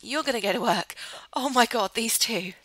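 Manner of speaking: usual speaking voice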